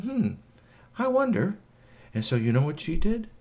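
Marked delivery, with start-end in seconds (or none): 0.0-1.6 high, musing voice; pondering hostess. Acted. Shui listens to Igglypop and wonders, high and musing. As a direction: wonder aloud, pitched up.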